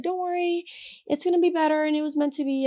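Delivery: high, softish self-reassurance; convincing herself. Retold or imitated. Upset woman talking herself round, high reassuring voice. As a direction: reassure yourself in a high, small voice. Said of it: "I was trying to convince myself".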